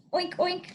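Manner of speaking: high, nasal-free playful oink oink; piggy oink. Shown as a demonstration. Teacher oinking for the class. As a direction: squeal it like a cartoon pig. Teacher voicing pig sound.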